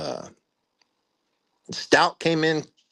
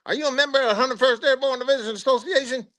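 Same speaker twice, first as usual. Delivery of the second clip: much higher, a put-on sly sergeant voice; imitating the first sergeant; 13 semitones higher, faster than usual. First sergeant quizzing a soldier about the association, high put-on voice. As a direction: play the crafty sergeant baiting a soldier.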